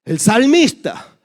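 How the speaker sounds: ordinary talk, nothing marked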